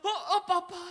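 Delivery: hammed-up teary daughter; mock sobbing daughter; moved daughter. Daughter answers father, moved.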